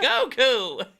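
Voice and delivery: put-on high, loud old man voice cracking into a laugh; old master calling out, laughing; Roshi old man voice. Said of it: "I gotta get my old man voice back".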